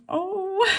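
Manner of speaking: high, drawn-out little "oh"; retold surprised realization. Fan realizing whose section they sat in, high surprise. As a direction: let a high "oh" of discovery slip out.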